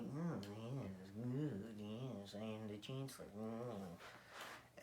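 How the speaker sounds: low, soft, sinister purr; evil Palpatine voice. Retold or imitated. Kid telling the story mimics the evil Chancellor, low and soft. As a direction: croon it like a gloating villain. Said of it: "in his chair looking all evil".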